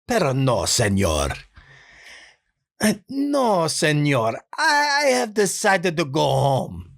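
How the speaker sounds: put-on high, pleading dubbed-movie Spanish accent; Spanish accent, like a dubbed film. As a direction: sound like an old Spanish dub. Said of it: "hoarse, like, scratchy voice".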